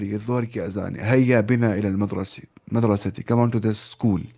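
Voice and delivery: ordinary talk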